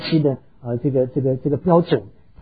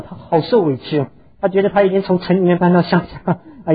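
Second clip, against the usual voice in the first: ordinary talk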